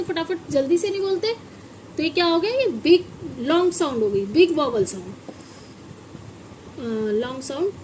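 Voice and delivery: ordinary talk, nothing marked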